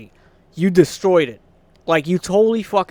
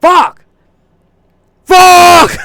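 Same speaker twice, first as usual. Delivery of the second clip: full-throated screamed curse, much higher and louder; raging yell at a bad show; screaming curse; 13 semitones higher, 16 dB louder than usual. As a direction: scream it out at the top of your lungs. Raging quote of own reaction.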